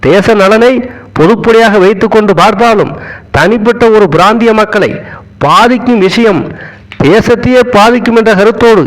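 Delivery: normal talking voice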